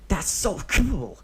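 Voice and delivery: high, slow, drawn-out wonder; a kid thrilled by danger; awed child. Acted. Deidara amazed by Obito's secrets, slow high awed child voice.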